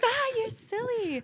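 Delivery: very high, drawn-out silly voice; giggly mock-cutesy dismissal; playful brush-off. Acts out playful reply to insult.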